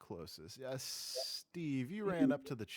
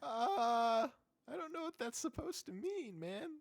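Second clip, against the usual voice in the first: whiny imp voice; 10 semitones higher than usual. Acted.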